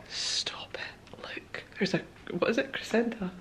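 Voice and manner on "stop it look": hushed, disbelieving whisper; barely audible amazement; awed whisper. Real, not acted. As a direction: whisper it as if too amazed to speak. Awestruck at the room's treats.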